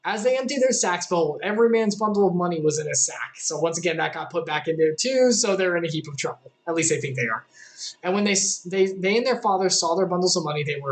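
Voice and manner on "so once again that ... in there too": ordinary talk, nothing marked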